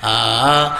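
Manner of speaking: ordinary delivery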